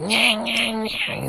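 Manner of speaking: mock him as a whiny nasal pundit. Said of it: "he's got, like, that whiny voice".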